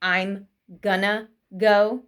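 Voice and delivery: "gonna" slowly as gun-nuh, words set apart; slow reduced form. Shown as a demonstration. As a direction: demonstrate "gonna" word by word, ending in a nuh. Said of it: "it turns into, like, an N A".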